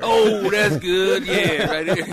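loud, high, drawn-out Texan reaction; big mock Texan holler; Texan local. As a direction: hoot slowly like an impressed local.

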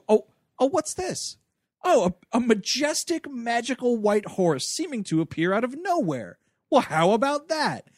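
high, wonder-struck put-on surprise; hammed-up discovery; mock amazement. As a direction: marvel exaggeratedly at the unexpected. Acting out finding a magical horse.

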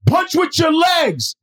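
high, drawn-out mock shout; mock coach yell. Coaches correcting the novice boxer, imitated high yell. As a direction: imitate a coach hollering instructions slowly.

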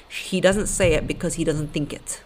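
usual speaking voice

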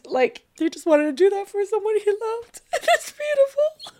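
choked up with tenderness; tearful. Real, not acted. About the husband who did it for love, tearful and choked. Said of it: "my one crying session a month".

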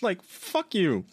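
slow, high, exasperated fuck you; escalating comedy rant. Real, not acted. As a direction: drag it out in disgust.

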